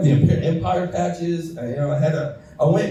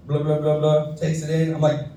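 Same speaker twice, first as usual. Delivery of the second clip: ordinary talk, nothing marked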